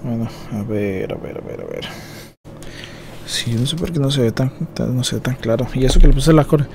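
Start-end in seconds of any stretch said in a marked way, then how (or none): 0.0-4.3 cursing under breath. Real, not acted. Muttering to himself.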